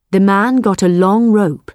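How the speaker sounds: plain reading voice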